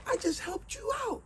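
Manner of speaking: high, hurt, wailing protest; betrayed bird. A narrator's reading. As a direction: bird cries out at being betrayed. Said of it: "And then the bird said".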